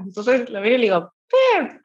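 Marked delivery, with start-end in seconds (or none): 1.3-1.8 eager call. Retold or imitated. Calling Pep to get attention.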